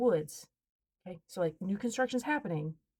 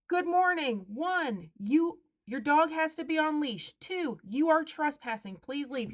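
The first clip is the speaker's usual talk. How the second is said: hollering from a distance, stern and deliberate; firm hollering; 7 semitones higher, slower than usual. Retold or imitated. Confronting trespassers at the woods' edge.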